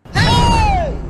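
loud, very high "hey!"; high shriek. Acted. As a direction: shriek it out long and piercing. Maid shrieks at boss's declaration.

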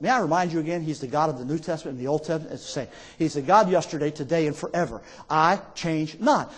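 low, soft, measured insistence; quiet firm reminder. Real, not acted. Preacher reminding doubters about both Testaments, quiet and firm. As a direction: keep it low, slowing on the last words.

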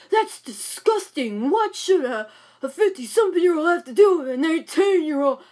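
a hint of a scandalised mother; mock disgusted mother. Daughter imitates her mother's disgusted lecture, lightly mocking.

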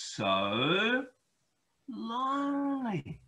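slow, high, pitying lonely; drawn-out lonely sigh. A narrator's reading. Narrator lingering sadly on Harry's loneliness. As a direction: stretch it out with pity.

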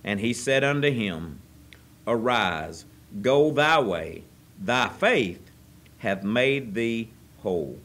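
slow, solemn King James reading; scripture reading. Acted. Preacher reading the verse to the congregation, solemn. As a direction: read the verse with reverence.

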